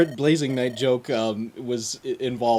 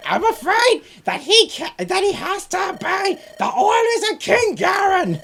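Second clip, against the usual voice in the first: very high, loud, drawn-out screech; earsplitting comic villain; Gilbert Gottfried impression; 4 dB louder than usual. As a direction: shriek every word slowly like a squawking parrot. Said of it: "he did it as Gilbert Gottfried's voice".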